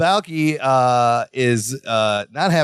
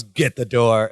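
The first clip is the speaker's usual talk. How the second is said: low, sulky mock order; mad at each other; 8 semitones lower, slower than usual. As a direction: give a grumpy imitation, pitched well down. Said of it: "they're both kinda, like, mad at each other".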